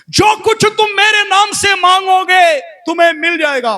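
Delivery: very high, far-carrying shout; shouted declaration. Acted. Pastor declares scripture promise in fasting prayer, high shouting. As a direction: cry out the promise at full pitch.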